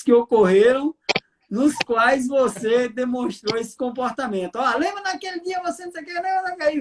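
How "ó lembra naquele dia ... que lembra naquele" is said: high, fast, light mock nagging; husband imitating his wife listing his past faults, quick and high. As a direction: rattle off the wife's old complaints, half-joking. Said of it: "ela fala assim".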